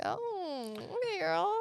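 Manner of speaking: put-on high, sneering "okay, girl"; mock them from up high; condescending. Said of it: "They're like".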